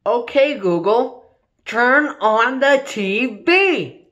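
each word drawn out slowly for the machine; slow deliberate command. Acted. User slowly repeating the command to the smart speaker. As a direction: spell it out slowly so the device gets it.

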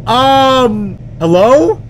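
high, loud nervous call. Real, not acted. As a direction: call into the dark house. Calling out in empty house.

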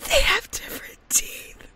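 high, squeaky whisper of disbelief; awed whisper. Real, not acted. Gamer repeating her discovery in disbelief, awed whisper. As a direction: whisper it, amazed and overcome.

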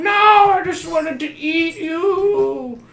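high, drawn-out, singsong mock coaxing; singsong villain coaxing. Player teasing a child in the game, slow singsong villainy. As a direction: coo it slowly like a playful child-eater.

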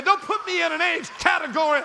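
high, insistent preacher's shout; defiant refusal. Acted. Older man refusing to be told to retire, defiant shout. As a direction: shout back in indignant refusal.

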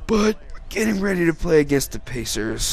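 yawning through the words. Real, not acted. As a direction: talk mid-yawn. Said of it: "I yawned".

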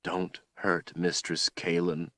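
soft, slow, tearful plea; quiet broken begging; crying plea. A narrator's reading. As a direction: beg through tears. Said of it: "Richard cried".

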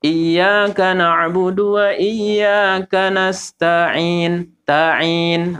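"Iyyaka na'budu wa iyyaka nasta'in" drawn out, ta and ain separate; slow correct recitation. Shown as a demonstration. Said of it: "pisahkan ta sama ain ini". (Iyyaka na'budu wa iyyaka nasta'in lambat, ta dan ain terpisah)

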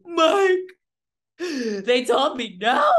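high, loud, fake-crying whine; mock sob of a rejected rookie; mock whiny cry. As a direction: whimper it like a crybaby running to the coach. Mocking rejected salesperson to coach.

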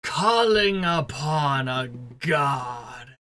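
very slow, drawn-out declamation; grand proclamation. Acted. Game master as the scientist calling upon a god, grandiose proclamation. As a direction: stretch it out like an incantation.

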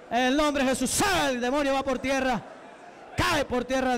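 forceful, high-pitched deliverance commands; exorcism preaching. Real, not acted. Preacher to a demon he is casting out, commanding. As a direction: order the demon out in rapid, insistent bursts.

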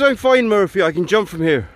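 high, loud, breezy Irish punchline; terrible Irish accent. Acted. Paddy reassuring Murphy at roof height, mock Irish accent. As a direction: deliver the punchline in a bad Irish brogue. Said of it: "apologies for the terrible accent".

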